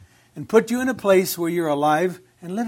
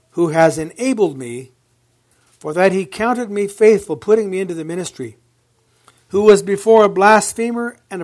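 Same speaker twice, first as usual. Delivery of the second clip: measured, ringing Bible reading; proclaiming scripture from the pulpit; 6 dB louder than usual. Acted. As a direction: read the verse out to the congregation. Reads scripture aloud.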